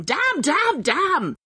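high stamping "Damn, damn, damn!"; a thwarted goblin cursing; childish tantrum curse; frustrated cursing. Acted. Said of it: "stamped around in the dirt".

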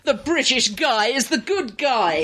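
high, drawn-out and emphatic; mock-triumphant proclamation. Host needling British co-hosts with a listener's point, mock-triumphant.

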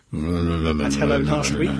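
low, slow, drawn-out mumble; mumbling. Pretend. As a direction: mumble in a low mock drone. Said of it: "stop mumbling at me".

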